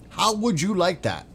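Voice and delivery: sing-song gloating challenge; taunting. Acted. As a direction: rub it in with a high jeering lilt.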